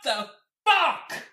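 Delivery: slow, high, loud disbelieving yell; drawn-out shocked curse through the door; muffled outraged cry. Acted. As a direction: bellow it from behind a closed door. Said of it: "you hear from outside the door".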